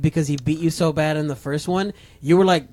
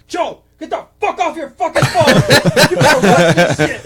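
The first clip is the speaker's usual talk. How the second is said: Tom; 8 semitones higher, 5 dB louder than usual. Retold or imitated. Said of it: "I just hear Tom's voice".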